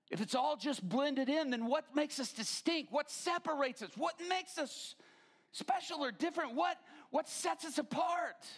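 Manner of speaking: demanding what sets us apart, shouting; preaching challenge. Retold or imitated. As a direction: build to a shout. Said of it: "and I said".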